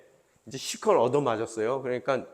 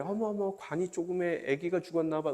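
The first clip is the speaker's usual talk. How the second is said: hushed, higher-pitched murmur of a passing bystander; gossiping passerby; 5 semitones higher, 5 dB quieter than usual. Retold or imitated. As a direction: imitate a bystander whispering in pity. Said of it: "뭐 이렇게 얘기하더라고요".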